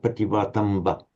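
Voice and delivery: "pativatamba" ending in a clipped short a; short final a. Shown as a demonstration. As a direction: keep the last a of "pativatamba" very short. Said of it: "it must remain short".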